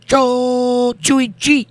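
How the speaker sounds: high, slow made-up alien words; mock Klingon. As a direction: stretch out fake Klingon syllables up high. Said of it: "Klingon sounds a lot like Russian".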